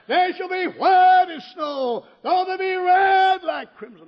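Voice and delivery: high, loud, slow scripture cry; fiery preacher proclaiming scripture; preaching shout. Acted. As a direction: thunder out the verse. Preacher quoting scripture at full force.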